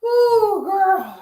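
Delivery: loud, high-pitched whoop; real rattled exclamation; still worked up. Real, not acted. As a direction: cry out to let off the shock. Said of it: "I haven't been calm down yet".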